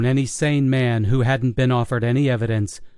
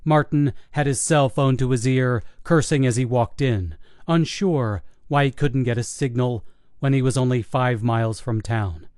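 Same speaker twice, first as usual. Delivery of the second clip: usual speaking voice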